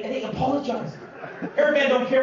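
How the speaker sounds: usual speaking voice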